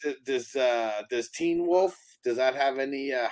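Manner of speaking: usual speaking voice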